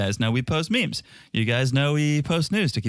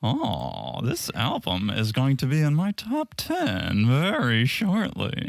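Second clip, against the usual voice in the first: slow, drawn-out parody voice; Sandy impression; 2 semitones higher, slower than usual. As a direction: stretch the words in a mock impersonation. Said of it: "that's exactly how Sandy sounds".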